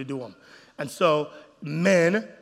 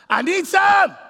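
high, loud pleading shout; husband's loud, high begging; needy husband; 12 semitones higher, 9 dB louder, slower than usual. Retold or imitated. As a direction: cry out like a starving man. Husband voiced wanting sex.

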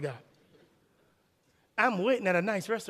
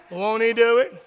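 smug brag; 3 dB louder than usual. Retold or imitated. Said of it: "what I said".

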